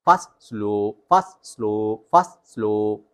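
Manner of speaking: Fast snapped quick, slow drawn long; fast then slow. Shown as a demonstration. Coach drills switching fast and slow. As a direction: clip fast, stretch slow. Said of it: "tone को रखना है".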